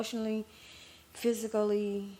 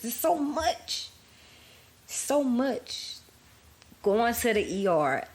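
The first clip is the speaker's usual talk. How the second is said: overwhelmed; 6 semitones higher, 5 dB louder, slower than usual. Real, not acted. Overwhelmed recounting medical ordeal.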